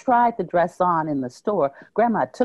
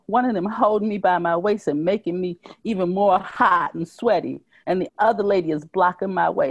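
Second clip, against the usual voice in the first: plain reading voice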